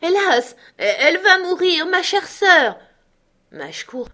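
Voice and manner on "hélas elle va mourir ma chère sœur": wailing sob, much higher and louder; crying shout. Acted. Drunk Benjamin still wailing over his sister, loud sobbing cry. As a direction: howl the lament through drunken tears. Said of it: "Benjamin criait toujours".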